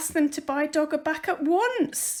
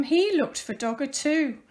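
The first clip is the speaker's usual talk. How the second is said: usual speaking voice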